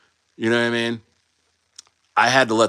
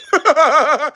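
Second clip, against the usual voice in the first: high laugh; 7 dB louder than usual. Real, not acted. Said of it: "laugh heard".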